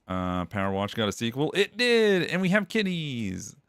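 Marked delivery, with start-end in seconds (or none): 1.5-3.6 high delighted exclamation; excited. Real, not acted. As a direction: gush over the kitties. Delighted at new game cats.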